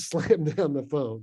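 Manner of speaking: normal talking voice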